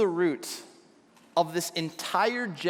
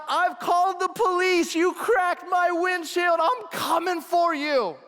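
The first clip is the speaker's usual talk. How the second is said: retold yell; 9 semitones higher than usual. Pastor yelling after kids who threw ice, retold shout. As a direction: call out threats across the road. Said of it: "I yell out".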